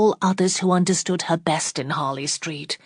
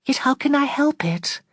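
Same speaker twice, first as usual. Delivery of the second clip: sadly; 9 semitones higher than usual. A narrator's reading. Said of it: "said she sadly".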